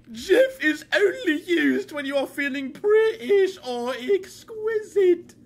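mock a pompous know-it-all; mock posh lecture. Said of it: "giving me a fucking English lesson".

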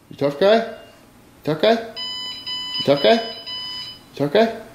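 high, rising needling little voice; mock tough-guy taunt. Vlogger needling someone as a tough guy, mock taunt.

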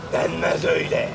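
low, husky monster verdict. Acted. Monster refusing the children's names, low monster voice. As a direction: rule it out in a deep monster voice.

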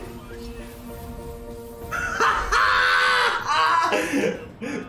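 shrieking jackpot winner. Real, not acted. Big slot win celebration.

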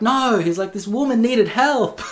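high-pitched, comically insistent protest; mock protest. Student protesting to his sensei, mock indignant and high.

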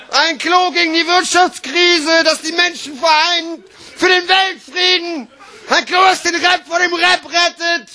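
high, exclaimed sales proclamation; bombastic mock advert. Slam poet hailing a toilet model, bombastic proclamation. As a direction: proclaim the product as if saving the world.

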